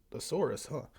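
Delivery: ordinary delivery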